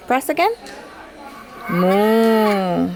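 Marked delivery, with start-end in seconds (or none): 1.7-2.9 one long, smooth moo; cow moo imitation. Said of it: "That's the cow".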